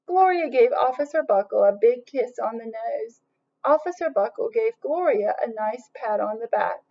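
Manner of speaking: usual speaking voice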